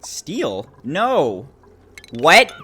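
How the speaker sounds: sudden high disbelief; shocked. Real, not acted. Shocked at steel in wires.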